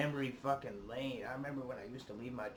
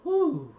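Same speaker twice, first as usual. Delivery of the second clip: big shaky exhale, high and loud; loud whew; 13 dB louder than usual. Real, not acted. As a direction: blow it all out in one loud breath. Overwhelmed after emotional song.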